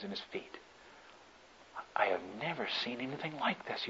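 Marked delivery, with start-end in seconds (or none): 2.0-3.9 soft, hushed murmur in shock; to yourself. Acted. Bystander telling himself in shock, murmured softly. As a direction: say it low under your breath, stunned. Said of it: "you tell to yourself".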